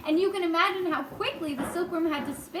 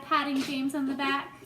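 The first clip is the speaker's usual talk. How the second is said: ordinary delivery, nothing marked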